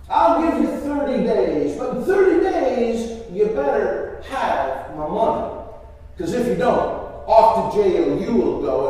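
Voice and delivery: loud, emphatic ultimatum; stern warning. Acted. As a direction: lay down the law to a debtor. Tax collector threatens the debtor.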